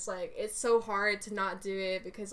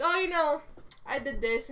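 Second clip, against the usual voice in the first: higher, louder boastful sister; twin sister's voice; 6 semitones higher, 4 dB louder than usual. Pretend. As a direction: toss it off proudly as the sister. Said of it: "and she's like".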